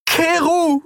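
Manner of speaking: very high, desperate call; desperate cry. A narrator's reading. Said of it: "rief sie verzweifelt". (hoher, verzweifelter Schrei)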